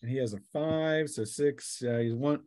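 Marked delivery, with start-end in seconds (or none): none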